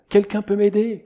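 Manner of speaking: high-pitched plea up to heaven; acted call for help. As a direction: imitate a stranded climber calling out. Acts climber calling for help.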